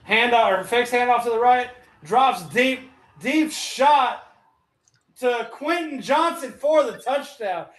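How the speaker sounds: rising, building touchdown call; excited play-by-play. Real, not acted. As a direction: call the long bomb as it lands. Live call of a touchdown pass.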